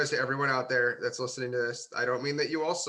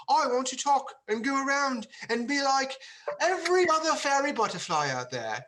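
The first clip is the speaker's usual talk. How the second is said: mock-dreamy, much higher voice; airy fairy player; 10 semitones higher than usual. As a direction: float it up high like a flighty roleplayer. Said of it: "players that are gonna be like".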